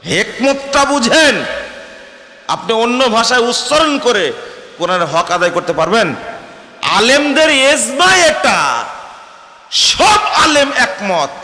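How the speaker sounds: very high, loud and slow sermon climax; preaching at full force. Acted. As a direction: stretch each phrase out at the top of the voice. (ধীরে টেনে টেনে উচ্চস্বরে ওয়াজ)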